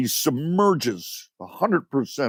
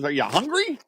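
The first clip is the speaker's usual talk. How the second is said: higher mock wife voice; husband imitates his wife's question in a higher voice; mimicking his wife; 7 semitones higher than usual. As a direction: play the wife, pitched up and light. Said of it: "and my wife says".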